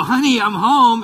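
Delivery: loud, high, beaming call through the house; cheerful call home. Retold or imitated. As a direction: call out to announce you're back, delighted. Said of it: "But I go".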